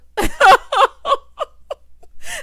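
very loud, high burst of laughter; real laugh. Real, not acted. Laughs at mom about to fib.

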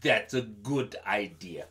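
low, soft snake agreeing. Acted. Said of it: "the long big snake says".

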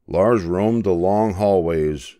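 plain reading voice

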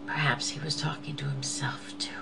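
breathy hushed aside; soft whispered musing. Real, not acted. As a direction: whisper it as a private thought. Reflective aside, unvoiced.

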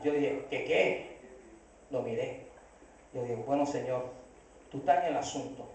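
very low and soft; stunned hush. Retold or imitated. Stunned customer turning to the Lord at the bank, hushed. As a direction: drop right down, as if muttering a private prayer.

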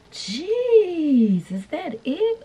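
high surprised yelp, jeez. Real, not acted. Reacting to the wig fitting at once, high surprised squeal.

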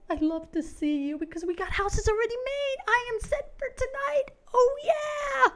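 high squeal building to a whoop; thrilled, rising to a loud cheer; jubilant celebration. Real, not acted. Celebrating ready-made houses.

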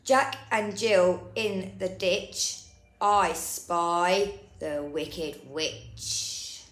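usual speaking voice

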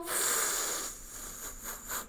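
soft, long "fff" of air; gentle voiceless blowing through the lips; quiet hedgehog snuffle. Shown as a demonstration. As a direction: imitate a hedgehog puffing quietly. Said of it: "Їжачок пофиркав тихо".

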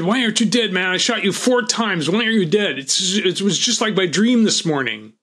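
the gunman panicking that his victim won't die; panicked yell. Retold or imitated. Gunman freaking out.

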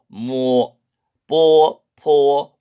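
모 뽀 포 spoken out one by one, 포 aspirated; 모 뽀 포 as a pronunciation model; Chinese syllable demo. Shown as a demonstration. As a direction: read 모, 뽀, 포 cleanly. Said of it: "이렇게 읽으면 됩니다".

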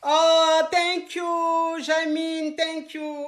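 high, drawn-out singsong thank-you; effusive thanks. Real, not acted. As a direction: gush the thanks, stretching the vowels. Thanking viewers for gifts live.

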